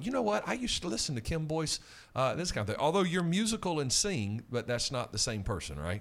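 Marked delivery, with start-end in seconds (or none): 0.0-1.8 lightly voiced listener, higher pitch; imagined listener. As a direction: pipe up higher as a curious listener. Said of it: "we may have a listener going".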